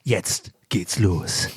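very slow low announcer; drawn-out ad-read voice; advertising voice. Acted. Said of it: "wenn ich meine Werbestimme mache". (langsame Werbestimme)

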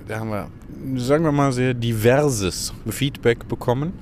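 ordinary talk

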